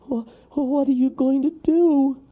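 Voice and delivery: high, stammering alarm; scared little character, loud and high; frightened gasp. A narrator's reading. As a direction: gasp it out in panic. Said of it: "gasped Wharton".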